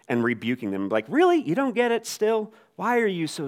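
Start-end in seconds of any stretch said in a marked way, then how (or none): 1.1-3.5 high, loud, mock-exasperated rebuke; snarky Jesus. Preacher mimicking a snarky Jesus rebuking disciples, mock exasperation. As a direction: sneer it like an impatient teacher. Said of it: "think Jesus is being, like, snarky".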